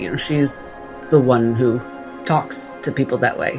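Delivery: ordinary talk, nothing marked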